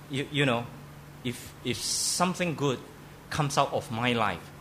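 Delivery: ordinary talk, nothing marked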